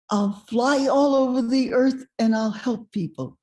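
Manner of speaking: imitated youthful eagerness; girlish declaration. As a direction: declare a big dream as a girl. Young self declares her dream.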